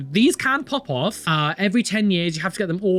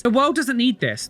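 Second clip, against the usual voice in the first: higher and run together; fed-up, raised-pitch outburst; exasperated repeat; 7 semitones higher than usual. Real, not acted. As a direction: rattle it off in high-pitched exasperation. Same slogan repeated quickly, fed up.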